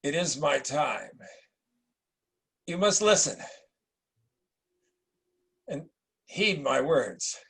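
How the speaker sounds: slow, grave dying emperor; a lightly voiced dying ruler. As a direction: give your son a last command, slowly. Dying emperor gives final charge.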